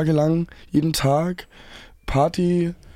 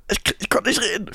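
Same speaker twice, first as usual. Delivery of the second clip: voice gone; 6 semitones higher, 4 dB quieter than usual. Retold or imitated. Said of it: "dass meine Stimme einfach komplett weg ist".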